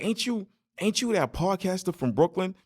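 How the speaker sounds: high-pitched, eager question from a passer-by; excited stranger. Retold or imitated. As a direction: imitate a stranger recognizing someone, pitched high. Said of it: "this dude was like".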